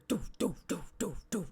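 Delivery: steady rhythmic doo-doo knocks; imitated thumping noise. Streamer mimicking noise from her laundry, rhythmic thumps. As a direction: sound out an even mechanical thump.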